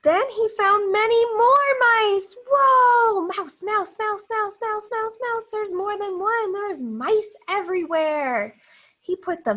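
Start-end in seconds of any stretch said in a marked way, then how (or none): 0.0-3.2 much higher and louder, thrilled; hammed-up storytime excitement; excited. Excited reading of many mice. 3.2-8.6 rapid higher mouse, mouse chant; playful higher counting of mice; excited counting. Acted.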